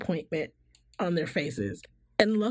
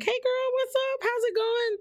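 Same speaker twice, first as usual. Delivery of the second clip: put-on much higher, bright party-girl hello; bubbly greeting. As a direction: greet a friend, all bubbly energy. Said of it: "I'm like".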